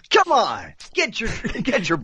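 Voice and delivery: upbeat can-do builder call; Bob the Builder voice. Shown as a demonstration. Voice actor doing his kids' cartoon character, cheery American builder. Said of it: "I was the voice of Bob the Builder".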